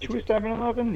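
incredulous echo. Real, not acted. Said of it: "incredulous echo".

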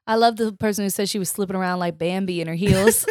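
normal talking voice

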